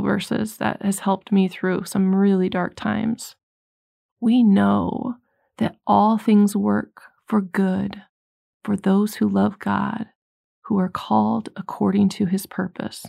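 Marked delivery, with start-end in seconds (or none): 4.2-13.1 slow scripture reading. Shown as a demonstration. Preacher slowly reading Romans to the congregation, solemn. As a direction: read the verse slowly and solemnly, word by word. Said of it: "It's Romans eight twenty-eight".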